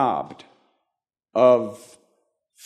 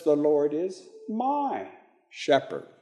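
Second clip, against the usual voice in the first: my stretched and lifted, pause after; stress on my; 6 dB quieter than usual. Shown as a demonstration. Teacher shows David makes it personal, stress on my. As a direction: hold my, then softly drop shepherd. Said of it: "He makes it personal".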